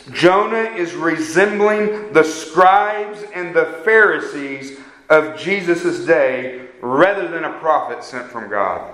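usual speaking voice

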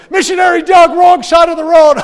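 laughing passengers yelling a warning. Retold or imitated. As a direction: holler from the back of the truck. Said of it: "these guys would yell to me".

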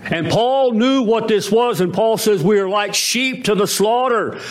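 fervent high-pitched sermon delivery; preaching. Acted. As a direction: preach it out with rising fervor. Preaching at full force.

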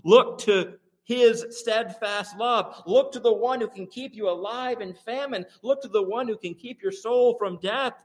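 the psalmist urging trust in the Lord, high and impassioned; preaching the psalm. Retold or imitated. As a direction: plead the psalmist's words with rising fervor. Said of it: "he says".

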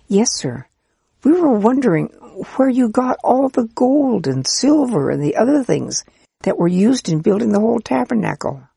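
put-on eager child's voice. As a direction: play a keen, polite little child. Children asking the priest.

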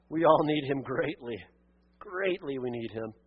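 hushed, reverent prayer fading away; closing prayer. Acted.

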